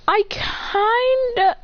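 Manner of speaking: high, very drawn-out hesitation; sheepish confession. Acted. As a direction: stall guiltily in a high voice. Acted sheepish confession to John.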